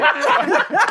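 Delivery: high squealing laugh. Real, not acted. Cohost teases the host for picking the old man, high squealing laugh.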